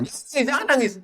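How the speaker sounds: put-on a little child scolding jangan nangis; child's voice. Child forbidding crying. (anak kecil melarang menangis, suara tinggi)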